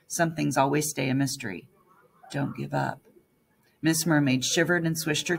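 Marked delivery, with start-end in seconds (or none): 2.3-3.0 faint inner voice. A narrator's reading. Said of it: "The voice in my head was louder yet".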